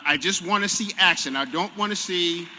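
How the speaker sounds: loud, frustrated plea. Real, not acted. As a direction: push it out with exasperation. Frustrated demand for action.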